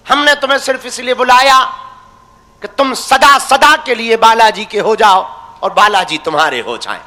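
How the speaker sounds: usual speaking voice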